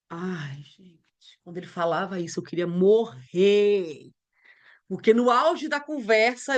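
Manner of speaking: ordinary talk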